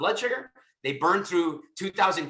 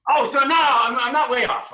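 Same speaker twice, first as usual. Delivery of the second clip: excited shout; 10 semitones higher, 10 dB louder than usual. Real, not acted. Pleased he guessed the accent.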